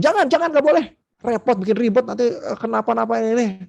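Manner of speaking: imitating a fussy parent shouting no at a child; scolding parent. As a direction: play a parent snapping at a toddler to stop. Parent forbidding a toddler.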